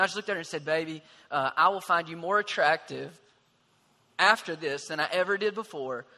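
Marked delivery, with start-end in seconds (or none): none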